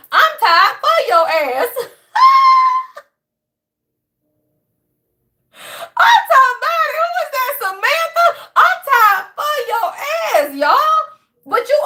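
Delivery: cracking up so hard the words squeak out high; laughing hard. Real, not acted. Laughing fit over her own line.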